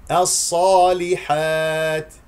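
"الصالحات" very slow and loud, heavy ص, light ل and ح; careful tajweed demo. Shown as a demonstration. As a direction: separate heavy ص from thin ل in "الصالحات". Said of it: "ركز على تخليص اللام والحاء المستفلة". (ترتيل "الصالحات" بصاد مفخمة ولام مرققة)

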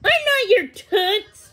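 high, loud, slow retort; offended outburst, each word drawn out; indignant. A narrator's reading. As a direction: snap back indignantly, pitch way up. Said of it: "Thumbelina said indignantly".

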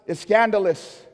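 loud, rising preacher cadence with every contrast hammered; fiery pulpit delivery; full-force preaching. Acted. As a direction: preach it to the back row.

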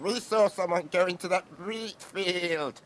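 lamb voice. Acted. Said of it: "cried the lambs".

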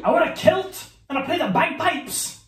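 high, loud Scottish brogue; Scottish accent. Acted. Player gives partner a clue, Scottish accent. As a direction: exclaim it like a caricature Scot.

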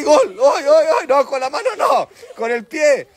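high-pitched, excited calls; excited coaching. Real, not acted. Narra y dirige el juego del niño. (gritos agudos de ánimo)

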